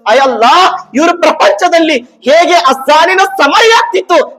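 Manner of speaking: high, loud sermon climax; full-force preaching. Real, not acted. Preacher declaring that all creation will bear witness, full-force preaching. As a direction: proclaim it urgently to the whole hall.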